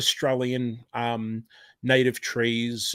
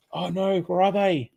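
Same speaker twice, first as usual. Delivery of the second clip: dismayed wail; 6 semitones higher than usual. Real, not acted. Streamer hunting for mislaid stickers, high dismayed wail. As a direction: wail it to yourself, voice pitched up. Searching desk for lost stickers.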